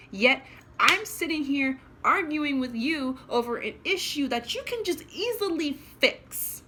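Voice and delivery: loud, high, fast angry tirade; furious rant. Real, not acted. Ranting at the hospital staff, furious and loud.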